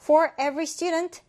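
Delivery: very slow, clear read for learners; slow model sentence. Shown as a demonstration. Teacher reading the example sentence slowly for students. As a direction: read each word out slowly. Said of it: "라고 할 때".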